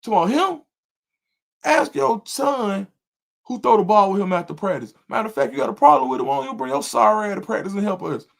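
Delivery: heated rant, pitch climbing high; going off. Retold or imitated. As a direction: tell the dad off, voice rising and fast. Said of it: "when I tell you I went off".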